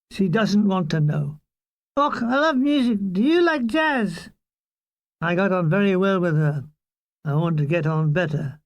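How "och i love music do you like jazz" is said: higher-pitched Scottish woman's voice; Scottish accent. A narrator's reading. Scottish sergeant asking a musician about jazz, higher Scottish accent.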